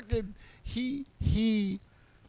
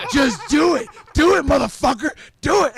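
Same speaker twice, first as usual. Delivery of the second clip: much higher and louder mock yell; yelled dare; 8 semitones higher, 13 dB louder than usual. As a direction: scream the dare at him full out. Retelling taunting him to choke.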